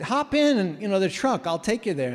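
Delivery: high, loud and eager, easing back down; imitating Howard. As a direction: call it out high and keen, then settle. Landowner offering a ride.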